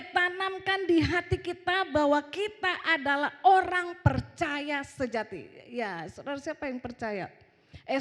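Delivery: emphatic preaching, loud opening then easing; khotbah lantang. Real, not acted. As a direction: drive the point into the congregation. Berkhotbah lantang. (khotbah penuh penekanan)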